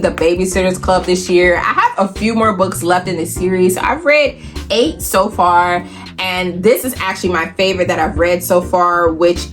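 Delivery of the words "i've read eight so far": normal talking voice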